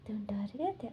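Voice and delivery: soft whispered question. Retold or imitated. Wing asking Wangjing in a soft whisper. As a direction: breathe it out in a whisper. Tender question after a hug.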